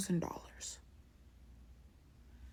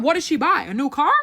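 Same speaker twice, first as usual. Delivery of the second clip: shocked question; 6 semitones higher, 6 dB louder than usual. Retold or imitated.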